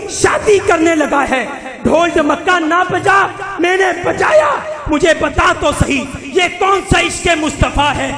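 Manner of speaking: ordinary talk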